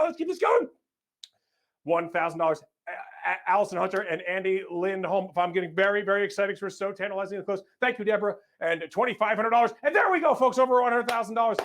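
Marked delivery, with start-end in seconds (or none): 0.0-0.7 excited cheer. Real, not acted. Auction host cheering donors on. 9.8-11.6 excited cheer. Real, not acted. Fundraiser passes its goal.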